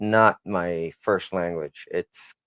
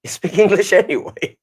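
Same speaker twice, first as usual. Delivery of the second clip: higher, louder mock friend voice; 10 semitones higher, 6 dB louder than usual. Retelling a friend's teasing reply, mock higher voice. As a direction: throw the friend's teasing line back, pitched up. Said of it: "And then my friend says".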